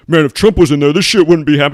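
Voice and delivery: loud, dopey mock of a Trump fan; mocking Trump supporter. Mocking Trump fans loudly. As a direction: ham up a gullible supporter. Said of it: "Trump people have in their head".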